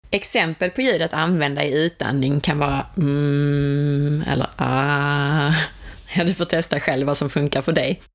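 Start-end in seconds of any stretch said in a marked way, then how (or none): 3.0-4.2 low, dark hum from the belly; deep hum. Shown as a demonstration. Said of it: "det ska vara mörkt, djupt ljud ända från magen". 4.6-5.5 slow, dark "ah" from the belly; deep drawn-out ah. Shown as a demonstration. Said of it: "det ska vara mörkt, djupt ljud ända från magen".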